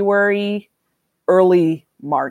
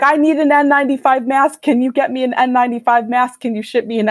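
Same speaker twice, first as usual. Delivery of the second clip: higher-pitched, urgent retold begging; rushed mock panic, pitched up; panicked plea; 6 semitones higher, faster than usual. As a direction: act out a friend pleading in a panic. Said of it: "like, in a panic".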